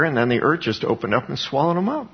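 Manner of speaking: ordinary talk, nothing marked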